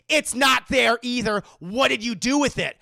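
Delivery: loud angry accusing yell; furious shout. Retold or imitated. Mother yelling at her daughter over the lost cup. Mom berating Anna.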